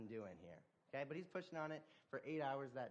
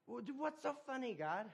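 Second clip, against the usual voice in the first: indignant, surprised question; 12 semitones higher, 5 dB louder than usual. Acted. Man reacts to God laughing.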